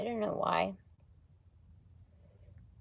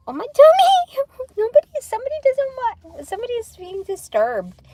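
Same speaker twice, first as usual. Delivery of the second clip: very high, loud shriek then laughing; sudden squeal of alarm; startled yelp; 11 dB louder than usual. Real, not acted. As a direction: cry out as something jolts you.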